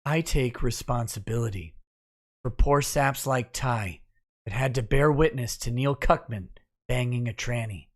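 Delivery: mock-solemn, low and hushed, with long pauses; sad celebrity apology. Parodying celebrity apology videos on request, mock-sad and subdued. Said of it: "in the sad, "I take responsibility" celebrity voice".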